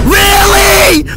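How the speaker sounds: high, loud shocked shriek; outraged disbelief. Acted. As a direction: shriek it, betrayed. Outraged at dog's no.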